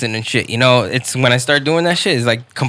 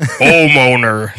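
slow, bass-heavy homeowner voice; 4 dB louder, much slower than usual. Shown as a demonstration. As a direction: drag it out like a homeowner. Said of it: "You just raise that low, low shelf".

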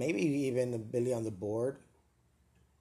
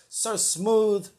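high, loud calling of the name; mock pleading; 7 semitones higher, 9 dB louder, much slower than usual. Host calling out to his new boss, mock desperate. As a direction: call out to him, hammed-up.

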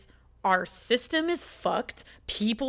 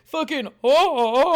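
ordinary delivery

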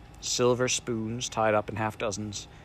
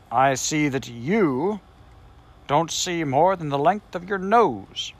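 slow, raised and louder scolding; cross; 5 semitones higher, 5 dB louder, slower than usual. A narrator's reading. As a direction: talk down at him, annoyed. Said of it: "said the Samiad crossly".

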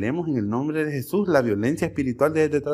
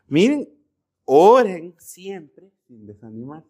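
preaching emphasis; 4 semitones higher, 6 dB louder, slower than usual. Real, not acted. Preacher repeats Jesus' command.

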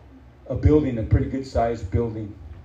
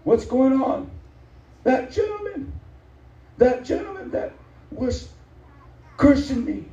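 quoting a crying sister, pitched way up; tearful high voice; 12 semitones higher than usual. Retold or imitated. Sister calling the pastor in tears, high tearful voice. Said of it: "and she's crying".

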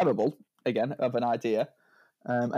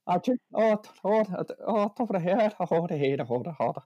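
stammering attempt at Irish; mock Irish, starting high and coming down; Irish accent; 5 semitones higher than usual. Shown as a demonstration. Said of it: "everyone's speaking like that".

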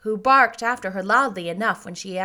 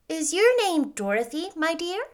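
lightly voiced old lady, pitched up; 8 semitones higher than usual. A narrator's reading. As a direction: ask a child kindly as an old woman. Old lady questions the child.

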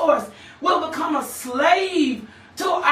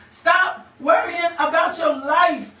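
usual speaking voice

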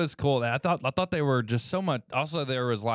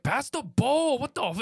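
high, loud Irish shout at the TV; Irish fan accent; 13 semitones higher, 4 dB louder than usual. Retold or imitated. Angry Irish fan shouting at the TV. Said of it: "he's like".

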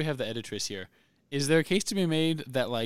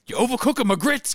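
high, loud hammed-up complaint; put-on movie quote voice; movie player impression; 11 semitones higher, 6 dB louder than usual. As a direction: holler it at the coach.

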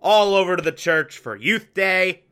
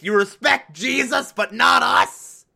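high mock yell, building to a shout; imitated angry yell; 4 semitones higher than usual. Reviewer acting a father yelling at his daughter, mock shout. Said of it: "just yells in her face".

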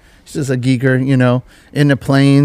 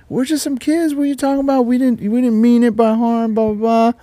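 high, softer whiny pleading; sob story; 9 semitones higher, 4 dB quieter than usual. Retold or imitated. To security, playing the sob story in a softer whiny plead. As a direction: play the innocent kids, begging. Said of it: "played the sob story".

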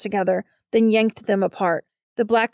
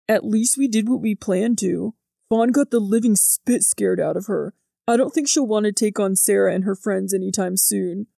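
put-on pleased teenage prankster, lightly characterised; a hint of a grin in the voice; understated character voice, satisfied with the prank; lightly voiced Jordan. A narrator's reading. Narrator voices grinning Jordan.

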